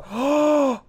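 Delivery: high-pitched voiced gasp; sharp gasp of shock; startled gasp. Real, not acted. Gamer caught by surprise attack, startled gasp. Gamer suddenly shot in game.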